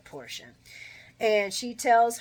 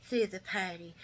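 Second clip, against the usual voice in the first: snide. Retold or imitated. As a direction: imitate someone drawing out a snide correction. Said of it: "Charles' snidely ass says".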